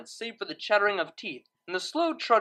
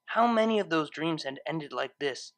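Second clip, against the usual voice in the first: usual speaking voice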